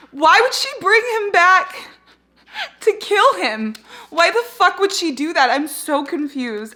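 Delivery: high, loud, bewildered protest; very upset. Real, not acted. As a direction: demand an answer, distraught. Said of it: "I am so upset right now".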